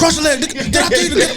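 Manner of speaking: high, loud squeaky shouting through laughter; Pinky. Pretend. Joking for friends after the comparison, loud high mock yelling. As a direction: yell orders in a comic high-pitched voice. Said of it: "You sound like Pinky right now for Friday".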